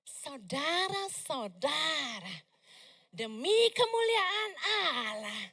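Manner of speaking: very slow, drawn-out, hushed preacher; a deliberately dull, slow sermon voice; soft sleepy preaching. Shown as a demonstration. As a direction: lull them to sleep with a slow drone. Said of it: "Coba saya ngomongnya".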